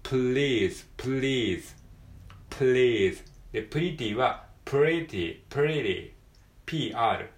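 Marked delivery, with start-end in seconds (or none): 0.1-6.1 please with a clear L and pretty with an R, contrasted; please versus pretty. Shown as a demonstration. Teacher contrasting L and R for Japanese learners. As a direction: set please against pretty. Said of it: "pleaseの方はピーエル".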